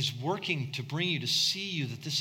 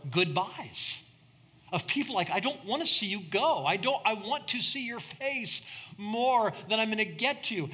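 higher, louder impassioned sermon build; preaching at full force; 7 semitones higher, 4 dB louder than usual. Acted. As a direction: preach it with rising fervor to the congregation.

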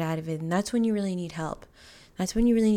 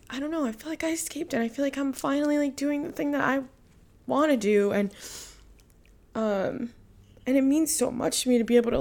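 emotional, near tears; 4 semitones higher than usual. Real, not acted. Podcaster telling listeners she escaped, choked with emotion. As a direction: voice rising and pitched up, welling with real emotion. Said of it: "I'm so emotional now".

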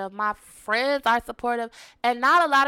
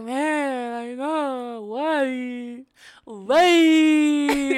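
hammed-up crying, rising loud on the last why; imitating her own ugly cry, building; mock sob wail; 3 semitones higher, 4 dB louder, much slower than usual. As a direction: pretend to bawl helplessly. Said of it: "I'm like".

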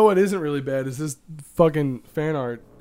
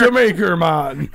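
put-on Jamaican accent; 6 dB louder, slower than usual. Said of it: "Jamaican pirate".

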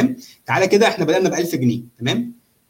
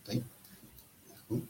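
ordinary delivery

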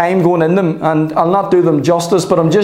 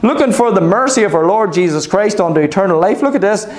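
usual speaking voice

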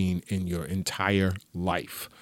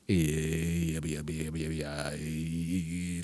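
low, mumbling stoned yeahs; 5 semitones lower, 8 dB quieter, much slower than usual. Retold or imitated. Comic imitating himself stoned during the movie. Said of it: "I was like".

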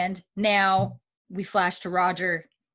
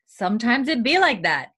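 shrugging sass; 5 semitones higher, 5 dB louder than usual. Retold or imitated. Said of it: "You're like".

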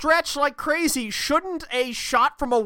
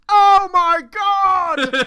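loud, drawn-out, higher-pitched cry; mock outraged shout; 6 semitones higher, 7 dB louder, much slower than usual. Co-host capping a betrayal joke, mock outraged shout. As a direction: shout it slowly in hammed-up disbelief. Joke outburst at the backsies bit.

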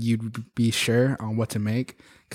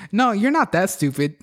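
blunt supervisor; 8 semitones higher than usual. Retold or imitated. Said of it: "The shift supervisor goes".

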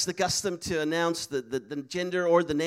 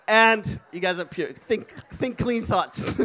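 laughing through the words, slow; laughing tease; 4 dB louder, slower than usual. Real, not acted. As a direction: chuckle while teasing the audience. Laughing at his own joke.

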